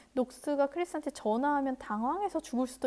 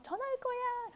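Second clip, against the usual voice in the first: Knox's line in a squeaky high voice, much higher; high put-on voice; 12 semitones higher than usual. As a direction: pitch it way up like a cartoon. Knox voiced, high far voice.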